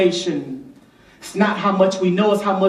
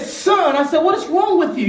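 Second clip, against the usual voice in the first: high, exasperated dad calling out to his son; mock-scold a toddler who ran into a wall; a father half-shouting at his son after the fall; retold scolding of son; 10 semitones higher than usual.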